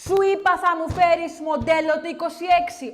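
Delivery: loud, furious phone rant; the boss screaming about his BMW model; angry shouting. Acted. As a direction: yell at the dealer down the phone.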